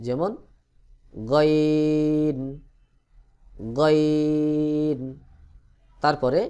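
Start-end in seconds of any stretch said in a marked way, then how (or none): 1.3-5.0 গইন from the end of the throat, held very long; long, sustained throat-made গইন; end-of-throat sound. Shown as a demonstration. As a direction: draw out গইন on one level tone. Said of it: "হলকের শেষ হইতে".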